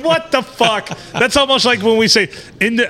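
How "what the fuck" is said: mock bafflement. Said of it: "I'm like".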